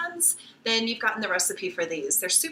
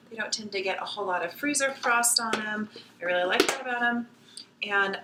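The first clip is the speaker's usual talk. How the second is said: ordinary talk, nothing marked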